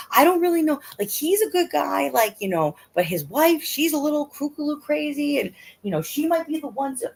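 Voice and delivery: put-on high, anxious kid chatter; kid's high worried voice. Mom imitating her son's friends, high and anxious. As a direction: voice the worried boys.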